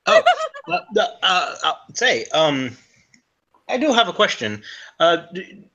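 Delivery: a bad Liam Neeson-style Irish accent; an Irishman with a shaky brogue. Irish traveller asking the scholar about libraries, bad Irish accent. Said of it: "You're at a bad Liam Neeson impression".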